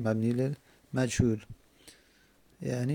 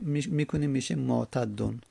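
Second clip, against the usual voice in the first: ordinary delivery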